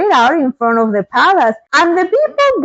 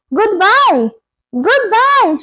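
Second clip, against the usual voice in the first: crowd shouting; 7 semitones higher, slower than usual. Acted. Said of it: "shouted everyone".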